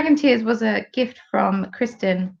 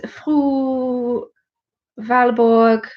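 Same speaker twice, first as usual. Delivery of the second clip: slow, careful stab at the Swedish name Fru Valborg; attempted Swedish; 4 semitones higher, much slower than usual. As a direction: sound out Fru Valborg in a hesitant Swedish accent. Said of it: "Please excuse my terrible Swedish".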